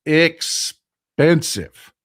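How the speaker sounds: expensive stretched very slow; drawn-out emphasis. Real, not acted. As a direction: drag out expensive for effect. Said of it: "stupid expensive".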